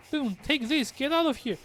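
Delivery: high, urgent cry to flee; desperate plea. Acted. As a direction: urge him to run, voice pitched high. Reads a captive's desperate plea.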